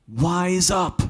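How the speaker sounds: put-on high, loud kid's shout of protest; childish yell. Small boy shocked by ice water yells at his brother. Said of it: "in my six or eight-year-old, top of my lungs voice".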